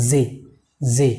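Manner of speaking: জি with a pressed, buzzing initial consonant; corrected pronunciation. Shown as a demonstration. Teacher modelling the letter for learners, careful corrected pronunciation. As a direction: lean on the first sound of জি. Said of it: "আমরা এভাবে উচ্চারণ করব".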